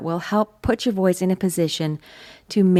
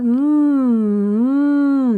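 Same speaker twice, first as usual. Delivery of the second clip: higher nasal hum; 7 semitones higher, 3 dB louder than usual. Shown as a demonstration. Coach demonstrating the nasal M hum. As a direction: hum through the nose. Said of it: "You can't really do an M without it being nasal".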